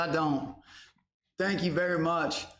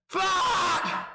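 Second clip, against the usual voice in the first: shout; 12 semitones higher than usual. Real, not acted. Hype scream.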